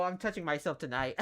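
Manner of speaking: ordinary delivery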